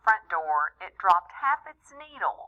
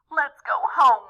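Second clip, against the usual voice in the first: louder, higher plea to leave; family cry; 7 semitones higher, 7 dB louder than usual. Acted. The family after the Santa line, loud fed-up cry. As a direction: call it out together, fed up. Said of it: "we all cried".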